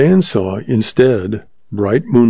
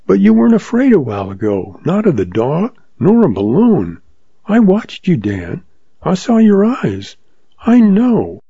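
put-on young woman June; 7 semitones higher than usual. June pleading.

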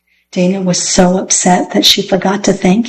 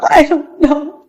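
put-on higher, tearful little girl; sobbing girl; 8 semitones higher than usual. A narrator's reading. Girl crying in the road about the car, sobbing. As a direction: choke it out, close to sobbing. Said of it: "Dana sobbed".

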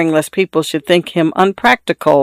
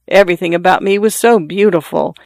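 ordinary delivery, nothing marked

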